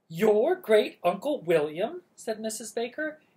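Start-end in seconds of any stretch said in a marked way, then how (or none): 0.1-2.0 loud, high startled question; taken aback, voice jumps up; surprised. Acted. Startled baker's wife answers.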